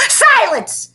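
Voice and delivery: very high, loud shout. A narrator's reading. The new teacher shouting at the librarian, very high and loud. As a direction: shout it to silence the room. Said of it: "shouted the new teacher".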